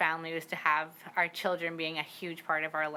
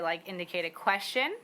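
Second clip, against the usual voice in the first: usual speaking voice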